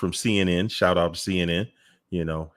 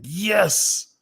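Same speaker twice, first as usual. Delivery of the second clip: imitated cheer; 12 semitones higher than usual. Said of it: "They was like".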